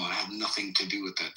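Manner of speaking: ordinary delivery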